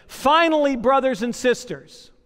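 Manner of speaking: scripture reading. Acted.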